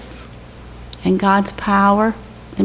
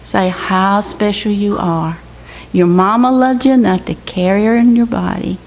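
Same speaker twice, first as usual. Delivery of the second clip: ordinary delivery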